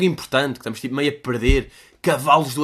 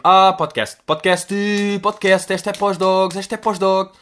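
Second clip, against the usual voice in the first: excited inner brainstorm; 5 semitones higher, 6 dB louder, slower than usual. Retold or imitated. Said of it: "um gajo estar a pensar".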